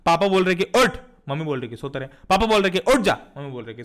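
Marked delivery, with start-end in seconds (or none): 0.6-1.0 loud, higher wake-up command; sudden loud one-word order; imitated father's order. Father's side of parents' tug-of-war. 1.8-2.1 put-on softer, lower, letting the child sleep; a hint of a soothing mum; lightly voiced mother. Mother's side, soothing against father. 2.8-3.2 loud wake-up command; short, forceful fatherly order; imitated father's order. Father's side of parents' tug-of-war.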